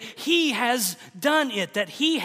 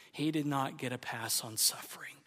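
breathy whispered line; 6 semitones lower, 11 dB quieter than usual. Acted. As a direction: whisper it as if in awe. Hushed climax of the sermon.

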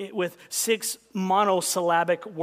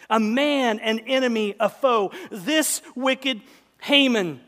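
accusing Esther; 5 semitones higher, 6 dB louder, slower than usual. Acted. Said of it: "Picture Esther pointing at Haman".

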